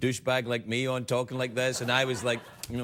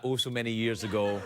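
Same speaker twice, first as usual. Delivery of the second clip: mock wistful; 4 semitones lower than usual. Shown as a demonstration. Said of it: "I have to say it like that".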